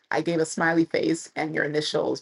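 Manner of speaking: ordinary talk, nothing marked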